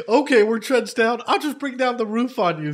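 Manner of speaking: mock cocky gamer. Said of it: "He's like".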